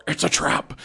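light mock alien-commander exclamation; a quick hint of a movie-character warning cry; Admiral Ackbar. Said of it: "Admiral Ackbar".

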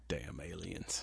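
hushed conspiratorial whisper. Pretend. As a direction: whisper it like a secret. Joking mock alien conspiracy.

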